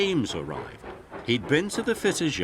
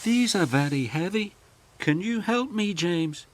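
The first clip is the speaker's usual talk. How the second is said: puffing Emily; 10 semitones higher than usual. A narrator's reading. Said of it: "she puffed".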